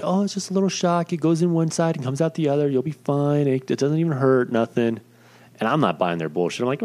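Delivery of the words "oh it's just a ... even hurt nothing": higher, sing-song soothing voice; mock reassurance. Staff reassuring him about the shock, mock-soothing higher voice. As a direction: coax him like a nurse calming a child. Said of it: "they're telling me".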